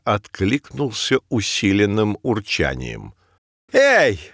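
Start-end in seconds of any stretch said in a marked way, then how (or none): 3.7-4.2 protest, outraged; indignant. Acted. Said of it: "возмутился Беляк".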